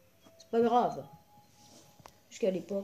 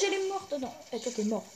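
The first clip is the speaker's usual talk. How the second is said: ordinary talk, nothing marked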